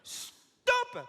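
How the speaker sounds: high retold stop; telling the chanter to stop; mock stop. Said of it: "j'ai dit stop!".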